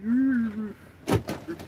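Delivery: playful mock growl pitched high; imitating dog growl. Retelling how the dog growls, mock growl. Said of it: "She's like".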